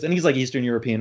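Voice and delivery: usual speaking voice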